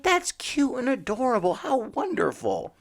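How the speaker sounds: over-the-top cooing at something cute; mock gushing. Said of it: "And I'm like".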